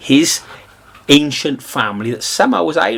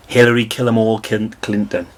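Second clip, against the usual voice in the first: ordinary talk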